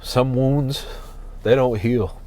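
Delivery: real tears held back, voice catching; fighting not to cry while talking; getting emotional. Real, not acted. Vlogger in his van chokes up about healing, holding back tears. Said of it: "I'm getting emotional".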